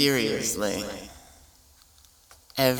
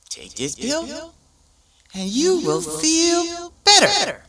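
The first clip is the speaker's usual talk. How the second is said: cheesy ad announcer; 9 semitones higher than usual. Retold or imitated. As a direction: sell the pill with fake cheer. Mock drug ad pitch.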